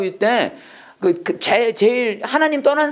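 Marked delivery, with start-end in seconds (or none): none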